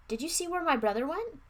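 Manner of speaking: high-pitched called question; calling out. A narrator's reading. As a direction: call the question over to people nearby. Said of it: "I called to them".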